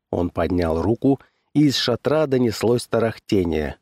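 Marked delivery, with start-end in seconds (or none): none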